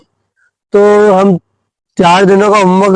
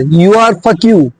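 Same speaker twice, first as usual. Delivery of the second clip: exaggerated slow English; much slower than usual. Boy proudly showing off his English to the principal, dragged out slowly. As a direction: spell out you are fuck you proudly, word by word.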